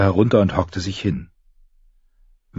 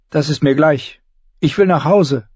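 put-on high, urgent girl's voice; a young girl getting upset; 8 semitones higher than usual.

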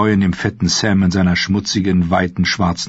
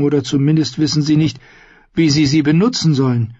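higher-pitched earnest character voice; hapless Sam explaining; 8 semitones higher than usual. Acted.

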